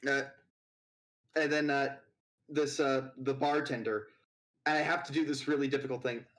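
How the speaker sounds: normal talking voice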